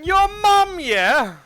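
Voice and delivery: high, loud, drawn-out jeer; mimicked shouted comeback. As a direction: belt out the catchphrase, slow and soaring.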